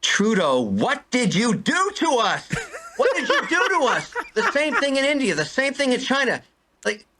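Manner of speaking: venting at Trudeau, pitch climbing; heartfelt rant. Real, not acted. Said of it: "thank you for that heartfelt".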